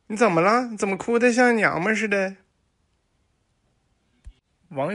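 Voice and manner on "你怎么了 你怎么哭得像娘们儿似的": higher, louder, fast nosy question; nosy neighbor. Acted. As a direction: blurt it out to the crying girlfriend. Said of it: "就问".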